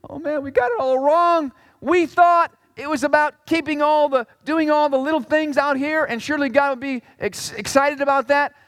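high, bright mock repentance; cheerful imagined confession; hoped-for reply.

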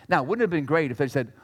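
ordinary delivery

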